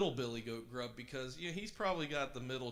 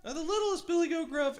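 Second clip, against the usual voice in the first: very high, loud squeaky little goat; tiny frightened goat voice, pitched way up; 10 dB louder than usual. A narrator's reading. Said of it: "answered the goat in a tiny little voice".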